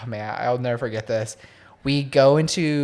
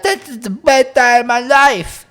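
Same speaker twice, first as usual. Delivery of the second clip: much higher, louder ecstatic yell; drunk Josh exclaiming; 10 semitones higher, 7 dB louder than usual. Retold or imitated. Friend imitates drunk Josh at the bar door, loud and high. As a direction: blurt it out like a wasted friend.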